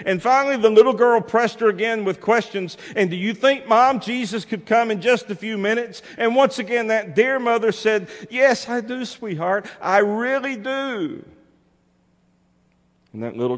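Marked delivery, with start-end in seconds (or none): 0.1-8.1 high, loud, urgent storytelling; preacher in full cry; forceful preaching. A narrator's reading. 8.3-11.2 high, loud, insistent reassurance; slow right down on the last emphatic words; imitated mother pressed once too often; exasperated mom. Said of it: "a little bit exasperated".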